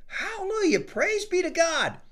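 high, ringing cry of praise; joyful exclamation. Acted. Frank praising God at the creek, high joyful cry. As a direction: burst out praising God, pitch way up.

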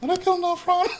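high, squeaky mock gasp of disbelief; shocked disbelief. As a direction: act stunned the character's being killed off. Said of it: "I'm like".

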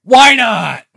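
loud, high, punchy "Why not?"; emphatic why not. Shown as a demonstration. Asking the listener why not, emphatic and loud. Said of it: "That's what I say".

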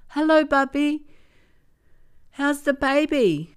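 put-on eager little girl on the phone; excited. A narrator's reading. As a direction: granddaughter greeting her grandmother, excited. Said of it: "she asked excitedly".

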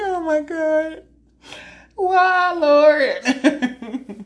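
a hammed-up laughing lament; mock despair. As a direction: cry out to God in mock anguish, laughing. Despairing after crude remark.

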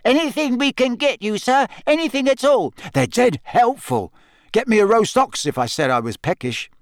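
very high squeaky house elf voice. Retold or imitated. Fred mimics a house elf, high squeaky voice. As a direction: squeak it like an eager little servant. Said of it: "put on a high-pitched squeak and imitated a house elf".

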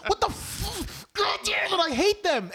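high, loud fed-up rant; fed-up viewer yelling at cable news; exasperated outburst. Retold or imitated. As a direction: blow up at the pundits, pitched high. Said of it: "And it's like".

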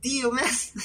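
high-pitched exasperated protest; put-upon, squeaky grievance; acted annoyance. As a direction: complain in mock indignation. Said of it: "se me notó mi incomodidad".

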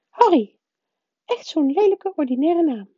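very high, stiff, disdainful woman's voice; disdainful wife. A narrator's reading. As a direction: pronounce it with high-pitched disapproval. Stijve vrouw kleineert de naam. (afkeurende echtgenote met hoge stem)

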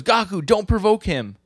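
high, loud put-on warning; hammed-up high-pitched protest; urgent warning. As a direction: imitate someone scolding a companion urgently. Acted warning to brother.

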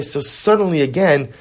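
normal talking voice

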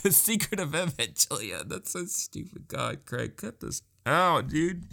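high-pitched, laughing through the words; laughing at himself. Real, not acted. Host asking Craig to cut his blunder, laughing at himself. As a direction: crack up helplessly at your own slip. Cracking up at own title slip.

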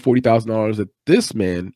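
ordinary delivery

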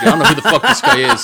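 high, fast, loud mock indignation; Chuck, pissed off. Streamer complains about a stranger in chat, mock pissed off. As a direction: imitate an annoyed man, laughter in the voice. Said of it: "Chuck started getting pissed off".

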